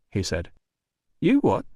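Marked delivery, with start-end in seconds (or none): 1.2-1.6 incredulous. A narrator's reading. Doorman incredulous.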